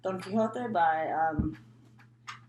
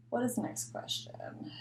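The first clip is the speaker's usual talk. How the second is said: whispered aside; 5 semitones higher, 6 dB quieter than usual. Real, not acted. Muttering to herself, reading the prompt.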